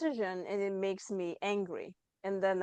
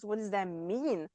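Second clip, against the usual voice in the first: usual speaking voice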